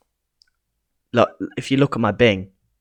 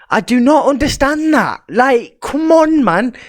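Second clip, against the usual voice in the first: exasperated outburst; 11 semitones higher, 4 dB louder, slower than usual. Real, not acted. Exasperated at not understanding politics.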